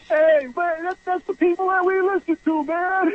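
high-pitched mock protest from a heckler; imitated indignant crowd. Caller imitates crowd encroaching on him, high mock protest.

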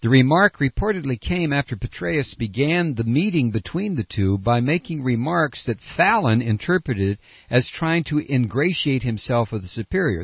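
ordinary talk

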